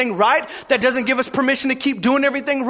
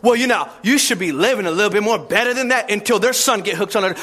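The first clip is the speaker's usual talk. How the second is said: mock religious voice; 6 semitones lower, faster than usual. Mocking religious people.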